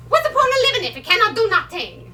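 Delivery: put-on high, loud, rapid Italian-accented complaint; exasperated outburst. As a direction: burst out fast in exasperation. Exasperated at losing her independence.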